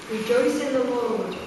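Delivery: usual speaking voice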